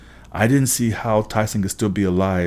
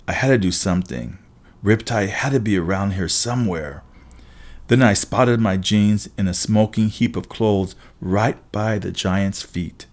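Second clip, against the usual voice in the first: plain reading voice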